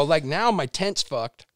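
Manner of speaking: usual speaking voice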